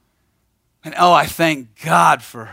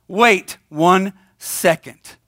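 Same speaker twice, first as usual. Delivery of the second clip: wait one second stretched out, slightly higher; slow, drawn-out command; 3 semitones higher, much slower than usual. Retold or imitated. To himself at midnight, a slow, drawn-out wait. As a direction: hold the panic back word by word.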